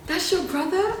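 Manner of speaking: mock starstruck question; excited fan. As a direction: ask it in high-pitched excited surprise. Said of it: "they get so excited".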